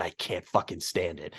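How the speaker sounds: muttered disgust. Real, not acted. Venting hatred of the voice.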